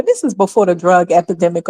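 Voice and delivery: ordinary talk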